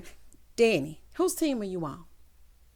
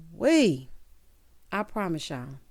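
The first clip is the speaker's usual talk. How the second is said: ordinary talk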